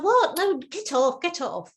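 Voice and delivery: sharp high snap, then brushing off; mock annoyed parent; irritated parent. Mother at the sink snapping at toddler, irritated. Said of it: "And it's like".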